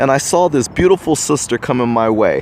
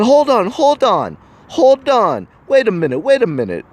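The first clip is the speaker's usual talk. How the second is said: protesting woman; 9 semitones higher than usual. Retold or imitated. Sister cutting off his accusation, high and insistent. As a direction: throw up a stop like an offended woman.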